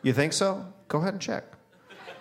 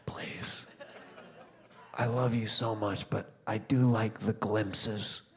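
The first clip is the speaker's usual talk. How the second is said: hushed pleading; 5 semitones lower, 9 dB quieter than usual. Retold or imitated. Begging toddler, hushed.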